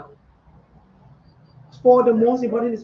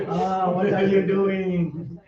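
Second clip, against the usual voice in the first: slow, drawn-out nagging questions; lightly voiced, as mindfulness itself haunting you; 3 semitones lower, slower than usual. Teacher voicing mindfulness haunting the student, slow and nagging. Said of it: "mindfulness is haunting you".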